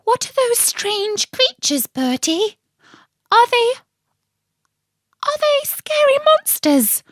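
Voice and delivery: very high, small creature voice; little Tim's high voice. Acted. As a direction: squeak it like a tiny frightened animal. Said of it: "said Tim".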